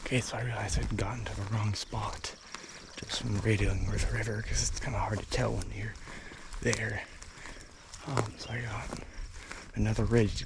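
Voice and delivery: soft unvoiced whisper on the ridge; wildlife watching whisper. Real, not acted. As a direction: whisper the update to camera. Stalking elk, keeping quiet.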